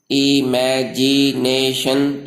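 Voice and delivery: Imagination slowly chanted in five separate syllables. Shown as a demonstration. Teacher repeats imagination for learners, slow syllable by syllable. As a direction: stretch each syllable of imagination evenly. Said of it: "पांच हरकतों से अदा होते हैं".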